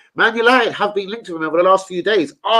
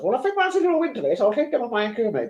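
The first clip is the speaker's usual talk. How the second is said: high, fast mock voice of a teammate; lightly lampooned gambler's voice; mocking imitation; 7 semitones higher, 5 dB quieter than usual. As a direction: take the mick out of him, quick and squeaky. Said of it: "he goes".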